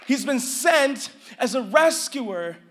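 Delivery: loud, high, slowed preaching with shouted peaks; full-force preaching. Acted. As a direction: preach it to the back row.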